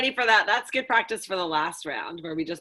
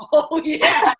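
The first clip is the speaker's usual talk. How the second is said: laughing; 6 semitones higher, 8 dB louder than usual. Real, not acted. After a burp.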